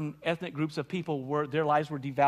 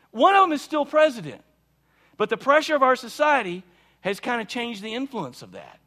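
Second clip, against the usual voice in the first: louder, higher, emphatic sermon voice; preaching at full force; 5 semitones higher, 7 dB louder than usual. Acted. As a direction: preach it hard to the congregation.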